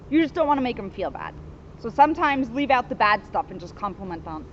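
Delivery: usual speaking voice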